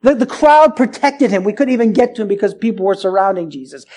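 normal talking voice

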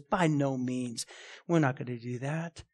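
low, hushed and firm; quiet refusal. Retold or imitated. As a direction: drop the voice right down for a grave refusal. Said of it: "that's what he's saying when he says".

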